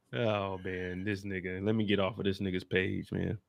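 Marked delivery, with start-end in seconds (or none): none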